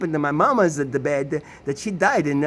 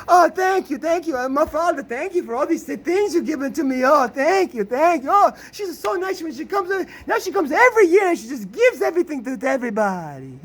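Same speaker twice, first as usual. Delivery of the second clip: much higher, mock-fawning thank-you voice; effusive thanks; 9 semitones higher than usual. Mother thanks the Italian relatives for gifts, high fawning mock voice. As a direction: overdo the grateful mother.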